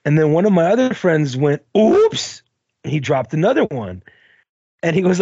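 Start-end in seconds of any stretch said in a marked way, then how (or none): 1.8-2.4 mock oops. Friend's fake accidental drop.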